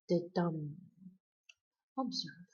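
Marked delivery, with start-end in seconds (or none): 0.1-0.7 light "the", stressed "dum"; weak-strong beat, "the DUM"; iambic stress. Shown as a demonstration. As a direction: punch "dum" after a weak "the". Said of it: "non-stressed syllable and a stressed syllable".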